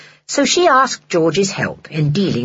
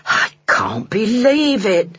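slow, higher, amazed; astonished, stretched-out words; disbelief; 4 semitones higher, slower than usual. Acted. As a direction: draw it out in wonder. Henny stunned at her luck.

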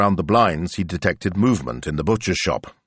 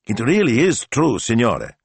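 higher, emphatic assurance; sincere raised-pitch insistence; earnest; 7 semitones higher, 4 dB louder than usual. A narrator's reading. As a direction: vouch for him eagerly. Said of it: "he said earnestly".

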